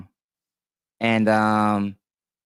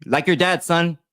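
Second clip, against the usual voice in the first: raised, higher, needling jab at the son; mock scolding; 6 semitones higher than usual. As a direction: tease him like a father scolding.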